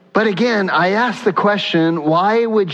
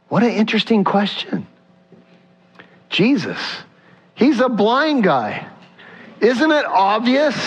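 mock incredulous; 8 semitones higher than usual. Preacher voicing bafflement at Jesus questioning a blind man, incredulous. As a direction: question it as if baffled by the obvious, pitched well up.

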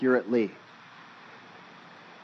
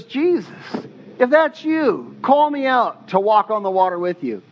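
eager, higher-pitched calling out; all excited; 4 semitones higher than usual. Retold or imitated. Peter calling to Jesus from the boat, excited. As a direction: act out an excited disciple calling across water. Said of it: "Peter gets all excited".

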